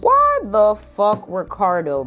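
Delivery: ordinary delivery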